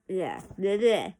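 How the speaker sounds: self-mocking "bleh" noises after a stumble; tongue-tied bleh. Real, not acted. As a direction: shake off the flub with blabbered blehs. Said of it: "I can't talk again".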